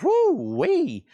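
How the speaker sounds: high squealing woo-wee; mock driver exclaiming. Seller voicing a driver in a stinky car, squealing.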